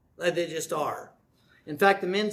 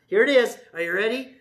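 normal talking voice

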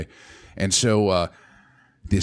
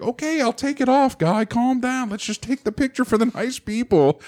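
mock placating. Placating angry photographer, retold.

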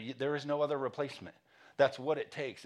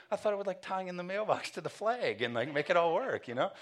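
higher, feigned innocence, playing dumb; mock innocent; 7 semitones higher than usual. Husband fakes innocence to his wife about the mailbox, mock innocent. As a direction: act like you know what you're doing. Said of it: "I try to act like I know what I'm doing".

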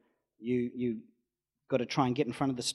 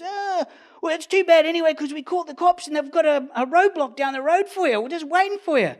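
much higher, louder smug taunt; mock gloating owner; 10 dB louder than usual. Owner taunting the driver about the police roadblock, smug mock gloat. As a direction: gloat like you've won.

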